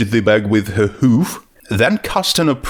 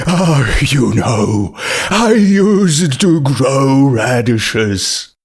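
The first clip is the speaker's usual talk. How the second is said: slow, nostalgic zombie voice; wistful sigh; 2 semitones higher, much slower than usual. A narrator's reading. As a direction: sigh over lost days. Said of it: "let out a long sigh".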